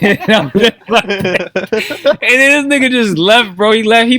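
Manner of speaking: can't get it out for laughing; cracking up over the ending. Real, not acted. Laughing at the McDonald's story.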